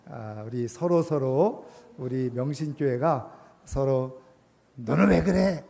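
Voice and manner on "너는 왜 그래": high, louder mock complaint; accusing. Church members picking at each other and the elder, acted-out accusing. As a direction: act out indignant fault-finding. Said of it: "자꾸 이러기는 것보다".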